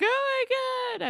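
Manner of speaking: high, loud imitation of freaking out; mock panicked scream. Spinner trapped in the tanning bed, mock panicked wail. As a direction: wail it like a claustrophobe shut in, hammed-up. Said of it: "He starts screaming".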